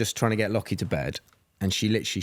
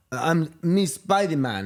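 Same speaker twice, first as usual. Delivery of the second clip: put-on higher, slow little-boy voice; toddler playing Spider-Man; 6 semitones higher, slower than usual. Dad imitating his son at the park, higher and slow. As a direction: imitate a three-year-old announcing himself. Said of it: "Lockie was just going".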